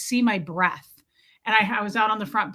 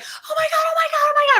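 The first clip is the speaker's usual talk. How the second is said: shrill high panicked oh my gods; panicked screaming; 5 dB louder, faster than usual. Retold or imitated. She screams as her cat brings in a live mouse, panicked shriek. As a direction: shriek it like a trapped panicked woman. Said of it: "I was screaming".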